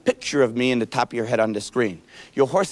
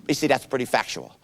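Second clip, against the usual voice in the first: usual speaking voice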